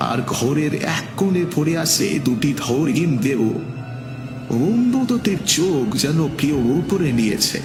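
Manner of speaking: ordinary delivery, nothing marked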